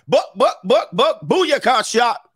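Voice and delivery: high mock gunfire chant; spray the words like wild shots; hammed-up booyaka gun noise.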